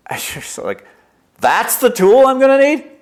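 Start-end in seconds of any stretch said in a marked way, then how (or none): 1.4-2.9 much higher, louder disbelieving cry; incredulous squeak. Pretend. You to God, staring at a jet engine, incredulous high cry. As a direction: gasp it out, you can't believe the size. You, shocked at God's tool.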